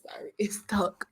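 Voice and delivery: hushed aside whisper. Real, not acted. Apologizing about the stuck mic, in a whisper. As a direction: drop to a whisper.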